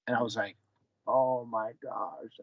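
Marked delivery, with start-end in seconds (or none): 1.1-2.4 slow, hushed "Oh my gosh", overwhelmed; stunned, moved. Retold or imitated. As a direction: let it sink in slowly, deeply moved. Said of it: "And that hit me".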